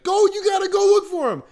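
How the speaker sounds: high, loud urgent yell; dad yelling at the rancher to search; frantic order. Retold or imitated. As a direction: shout the order in panic. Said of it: "my dad's like".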